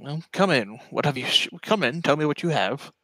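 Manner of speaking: lightly acted lich ushering guests in; lightly voiced Renwick the lich. Game master plays Renwick.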